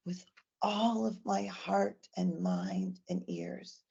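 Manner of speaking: put-on slow, soft whisper to the child; whispered promise. Shown as a demonstration. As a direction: hush it like a secret. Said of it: "then I'll tell the child".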